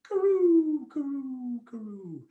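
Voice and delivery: high "karoo", falling and fading; rolling noise. Pretend. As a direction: imitate rolling away downhill. Imitates dreidel rolling down hill.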